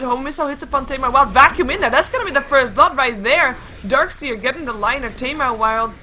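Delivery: loud, rising esports commentary; excited game caster; live play-by-play. Real, not acted. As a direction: build to the first kill with force.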